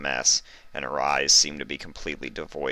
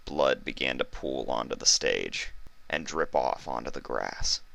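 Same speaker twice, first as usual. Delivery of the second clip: ordinary delivery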